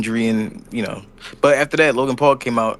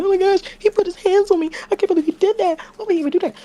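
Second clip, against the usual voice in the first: much higher, squealing complaint; whiny victim; faster than usual. Retold or imitated. Heyman whining to Punk after being pushed, high squeal. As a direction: play the wounded diva.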